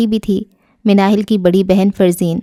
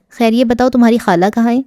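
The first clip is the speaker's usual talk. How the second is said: ordinary delivery, nothing marked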